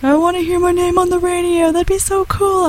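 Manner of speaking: put-on excited high child voice; squeaky kid, begging eagerly; little kid voice. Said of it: "my little cousin was like".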